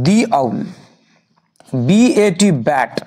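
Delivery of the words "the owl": the said "dee" before owl; long "ee" in the, lower pitch. Shown as a demonstration. Teacher answers what it will be, the as "dee". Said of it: "কি হবে".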